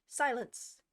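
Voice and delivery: loud sharp shout; called out. A narrator's reading. King silences the court with a loud call. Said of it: "called out".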